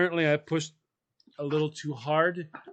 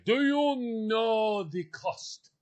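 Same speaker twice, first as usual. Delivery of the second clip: slow, drawn-out mock indignation; hammed-up French driver, slow and rising; imitated French driver; 4 semitones higher, 3 dB quieter, slower than usual. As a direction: play the offended car owner, stretching the question. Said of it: "He's pointing and hands are flailing".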